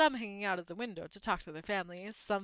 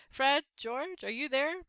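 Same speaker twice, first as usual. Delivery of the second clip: louder, higher call from a distance; 5 semitones higher, 5 dB louder than usual. A narrator's reading. Mother calling her twins from the platform. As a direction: call in through the train door.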